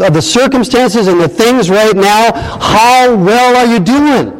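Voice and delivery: high, insistent pulpit challenge; challenging preaching. Real, not acted. Preacher asking listeners how their race goes, challenging preaching.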